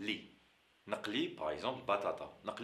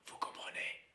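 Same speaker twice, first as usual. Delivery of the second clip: whisper; 8 dB quieter than usual. Real, not acted. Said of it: "whispered check".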